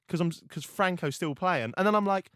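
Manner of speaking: ordinary talk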